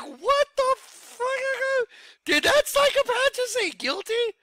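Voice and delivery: very high, slowed chipmunk squeal; stunned disbelief. Retold or imitated. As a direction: drag the first words out in a squeaky, stretched voice. Retold shock at the verdict.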